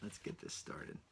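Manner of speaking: whispered to himself before starting; a private whisper before the episode begins. Real, not acted. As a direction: say it under your breath with no voice.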